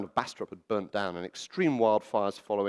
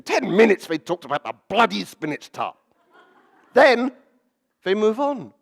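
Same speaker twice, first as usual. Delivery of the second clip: loud, raised mock exasperation; exasperated man quoted; 9 semitones higher, 8 dB louder than usual. Man complaining about endless spinach tart talk, exasperated. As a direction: retell his complaint high and loud. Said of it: "This is him speaking".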